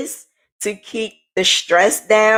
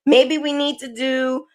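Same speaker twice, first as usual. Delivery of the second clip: imitating a worried therapist, pitched higher; a light impression of the therapist, pitched higher and pressing; 8 semitones higher than usual. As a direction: play the concerned therapist, voice raised up high. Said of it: "she just kept saying".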